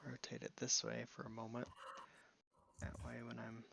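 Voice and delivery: unvoiced whisper while concentrating; whispering to himself. Real, not acted. Working alone on the tooth model, whispered self-talk. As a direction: murmur the step under your breath.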